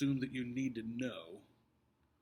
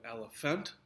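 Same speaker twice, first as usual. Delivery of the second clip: "elephant" said el-e-PHANT, rising as a question; stress on last syllable. Shown as a demonstration. Teacher tests wrong options on the class, "elephant" stressed el-e-PHANT. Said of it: "Which one is emphasized".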